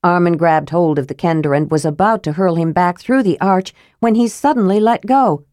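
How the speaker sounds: usual speaking voice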